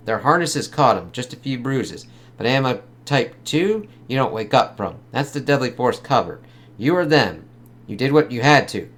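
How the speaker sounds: a hint of a tough detective; reading comic dialogue. Reads detectives' dialogue aloud.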